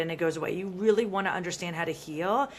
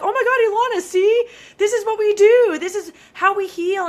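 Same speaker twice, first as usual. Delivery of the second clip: much higher and louder, thrilled; proud excited friend; 10 semitones higher, 10 dB louder than usual. Retold or imitated. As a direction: gush like a proud best friend. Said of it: "She was so proud of me".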